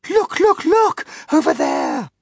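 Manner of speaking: very high, loud calls; frantic shouting. A narrator's reading. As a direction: shriek for everyone to look. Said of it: "shouted the ladybird".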